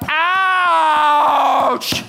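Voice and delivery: high, loud yelp of pain; hammed-up cry of pain; mock ouch. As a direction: yelp as if the saw bit. Acting pain of being sawed on.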